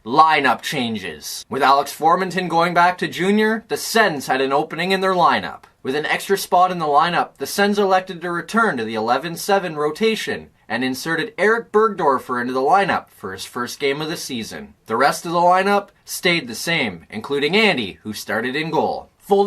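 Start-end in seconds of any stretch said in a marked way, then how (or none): none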